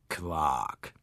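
low croaked interjection; frog croak. A narrator's reading. Frog great-grandfather croaks a word. (tief gequakt wie ein Frosch)

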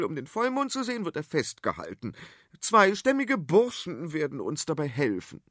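high, know-it-all queen lecturing; high-pitched royal scolding; bossy queen voice. Acted. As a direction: talk down to them like an impatient queen. Queen explains her plan.

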